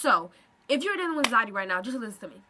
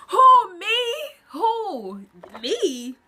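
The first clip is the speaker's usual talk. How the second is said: loud, high incredulous who me; mock disbelief; 7 semitones higher, 8 dB louder, much slower than usual. Imagining being told she'd be a receptionist, incredulous. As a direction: act stunned at the idea.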